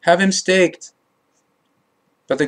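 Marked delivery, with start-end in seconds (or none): 0.0-0.9 mild narrated shout; restrained crowd shout. A narrator's reading. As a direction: call out like a crowd without really shouting. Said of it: "the people shouted and called out".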